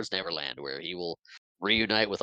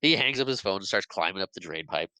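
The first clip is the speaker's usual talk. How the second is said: ordinary delivery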